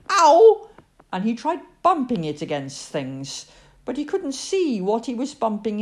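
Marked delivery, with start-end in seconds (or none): 0.1-0.7 high, loud yelp; sudden pained ow. A narrator's reading. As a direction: cry out at a bumped head. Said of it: "and mostly".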